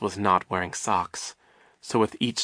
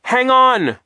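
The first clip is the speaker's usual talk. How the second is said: high, loud shout; urgent high-pitched call; urgent cry; 11 dB louder than usual. A narrator's reading. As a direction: shout encouragement in an emergency. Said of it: "Klaus cried".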